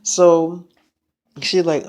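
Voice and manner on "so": ordinary talk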